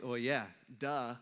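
low, slow, deadpan realisation; duh, obviously. Acted. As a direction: state the obvious, drawn out and low. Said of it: "like, the duh statement".

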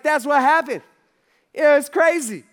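high, loud, emphatic wrap-up; worked-up storytelling. Acted. Peter ending his escape story to friends, loudly excited. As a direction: finish the wild tale at full excitement.

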